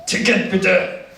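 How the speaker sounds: slow, stiff German ticket inspector. Retold or imitated. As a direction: play the stern tram controller, slow and clipped German. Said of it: "And he asks".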